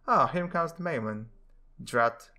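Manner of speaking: normal talking voice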